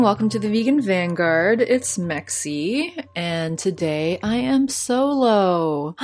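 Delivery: ordinary delivery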